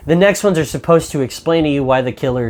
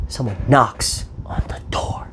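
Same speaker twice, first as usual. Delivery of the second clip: hushed whisper; 6 dB quieter than usual. Retold or imitated. Retelling the movie's tense moment to viewers, whispered slowly.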